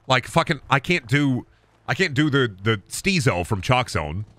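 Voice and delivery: ordinary talk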